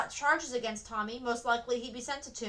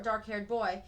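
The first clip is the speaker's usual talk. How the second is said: ordinary delivery, nothing marked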